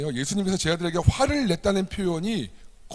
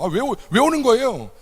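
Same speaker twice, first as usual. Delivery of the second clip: annoyed; 8 semitones higher than usual. Retold or imitated. Disciples shooing people away.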